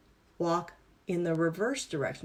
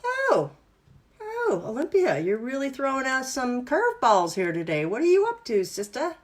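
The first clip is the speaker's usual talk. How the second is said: a high yelp, then playful, teasing surprise; the brain caught off guard, cheeky and wide-eyed; startled brain; 5 semitones higher than usual. Retold or imitated. Instructor voicing the brain as it teases Olympia, surprised. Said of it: "your brain's gonna go".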